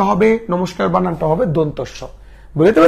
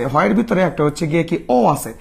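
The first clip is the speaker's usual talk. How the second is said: ordinary delivery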